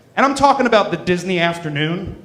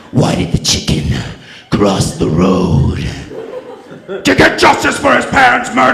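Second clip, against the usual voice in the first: Batman voice; 5 semitones higher, 4 dB louder than usual. Pretend. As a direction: rasp the joke like a brooding Batman. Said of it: "Batman would go first".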